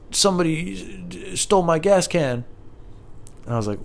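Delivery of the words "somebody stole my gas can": imitating neighbor. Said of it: "he's like".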